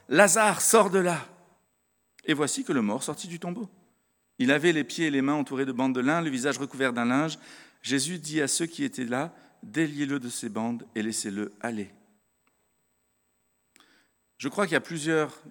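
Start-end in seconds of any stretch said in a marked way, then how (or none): 0.1-1.3 plus fort et plus aigu, appel à Lazare. Acted. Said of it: "il cria d'une voix forte". 2.2-11.9 lecture biblique. Acted.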